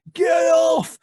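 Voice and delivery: very high shouted cry; shouting young male. Acted. Young lad shouts at his dad while grappling, very high. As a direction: yell it at the top of your range. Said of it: "shouts young male".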